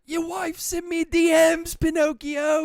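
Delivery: high, loud put-on Irish accent; hammed-up Irish cricket voice. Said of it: "Was that your Irish accent".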